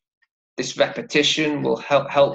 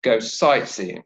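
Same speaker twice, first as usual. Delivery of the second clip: "sightseeing" stressed on SIGHT, falling low after it; 4 semitones lower than usual. Shown as a demonstration. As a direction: lean on "sight", let "seeing" drop. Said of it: "the word sight takes the stress".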